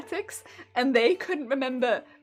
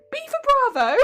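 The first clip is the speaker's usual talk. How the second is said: laughing quote; 9 semitones higher, 5 dB louder than usual. Retold or imitated. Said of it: "And I'd be like".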